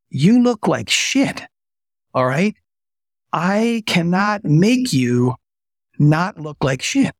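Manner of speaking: high, slow, deliberately blunt doctor's verdict; blunt, dramatic warning. Retold or imitated. Doctor bluntly telling the sick vice president he looks awful, high and slow. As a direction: draw it out to make the point land.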